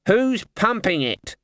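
high, drawn-out cry; mock scream. A narrator's reading. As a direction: shriek the question slowly, at moderate volume. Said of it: "screamed the senior wrangler".

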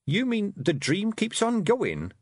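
put-on shocked young girl; Tiffany's voice. A narrator's reading. Tiffany scolding the Feegles, disbelieving girl voice.

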